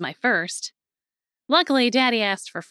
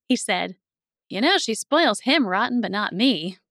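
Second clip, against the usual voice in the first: ordinary delivery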